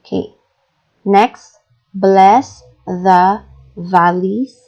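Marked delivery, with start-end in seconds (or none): 1.9-4.6 slow, BLESS stressed, rising end. Shown as a demonstration. Teacher reading slowly for students, stress on bless. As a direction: draw it out, lean on bless. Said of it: "with a single breath".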